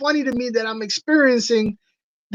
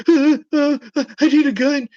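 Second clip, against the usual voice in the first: higher, stammering timid voice; imitating a nervous coward; mock scared guy; 4 semitones higher than usual. Mocking a scared dude who wants a gun, stammering. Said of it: "they're like".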